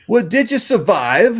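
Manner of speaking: mock-indignant retort; imitating a huffy Aussie waitress. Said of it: "she looks at me indignant and goes".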